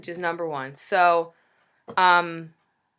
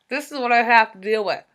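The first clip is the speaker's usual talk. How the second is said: talking around a bite splint; thick tongue, splint in mouth; 4 semitones higher, 5 dB louder than usual. Real, not acted. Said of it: "I sound like my tongue is choking myself".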